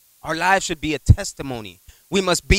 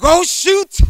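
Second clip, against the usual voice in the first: sudden high yelp of alarm; startled yelp; 6 dB louder than usual. Retold or imitated. Driver yelping as metal rod hits his truck. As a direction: cry out in shock, high and loud. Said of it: "I was like".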